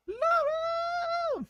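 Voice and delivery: very high, held playful wail; falsetto cry. Pretend. Host greeting Laura as she walks in, falsetto cry. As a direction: stretch the name out in falsetto. Calls out to arriving viewer.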